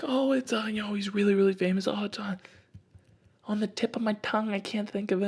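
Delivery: high-pitched, searching lady voice; hammed-up struggle to remember; imitated stranger lady. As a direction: grope for the name in a high put-on voice. Acting out lady straining to remember.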